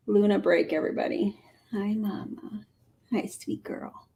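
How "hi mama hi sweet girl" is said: whispering. Real, not acted. Whispering to the cat.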